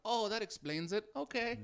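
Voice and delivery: high put-on Swiss accent; mock Swiss accent, high; Swiss girlfriend voice. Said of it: "She's like".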